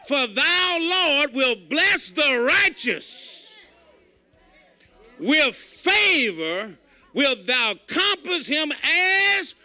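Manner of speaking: slow, drawn-out scripture read. Acted. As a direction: intone the psalm slowly, building. Reads scripture slowly, intoned.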